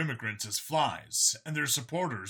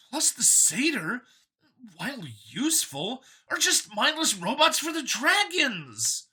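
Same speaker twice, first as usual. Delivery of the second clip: higher, louder, keen voice; a cheerful zealot pitched up; android copy, eager; 12 semitones higher, 4 dB louder than usual. A narrator's reading. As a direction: sell it like a proud believer. Copy insults species.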